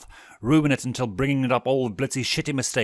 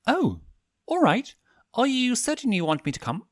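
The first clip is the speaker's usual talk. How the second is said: higher, gentle-voiced prince; Stolas voiced; 13 semitones higher than usual. A narrator's reading. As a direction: lift the voice for the polite owl prince, a little taken aback.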